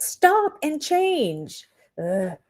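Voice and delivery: ordinary talk, nothing marked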